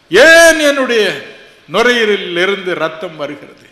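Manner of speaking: loud, high cries of why; desperate loud pleading; anguished plea. Retold or imitated. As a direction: hurl the questions at God in pain. Crying out to God why.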